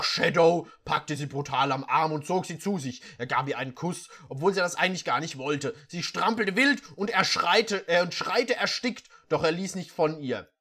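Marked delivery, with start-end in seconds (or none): none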